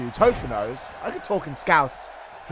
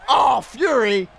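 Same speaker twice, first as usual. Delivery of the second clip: loud, high, drawn-out shout of mock outrage; outraged shout; 9 semitones higher, 8 dB louder, much slower than usual. Real, not acted. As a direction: yell the name in mock indignation.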